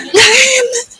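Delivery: usual speaking voice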